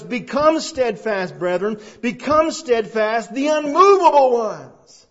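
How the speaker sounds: rising preacher's proclamation; emphatic translation reading. Shown as a demonstration. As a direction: proclaim it as scripture. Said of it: "it would say".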